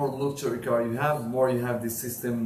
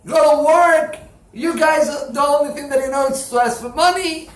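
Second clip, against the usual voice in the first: rising to a high, loud furious shout; angry yelling; 12 semitones higher, 11 dB louder than usual. Retold or imitated. Rich man yelling at the collector to get out, furious shout. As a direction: throw him out, yelling. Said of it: "He starts to yell at him".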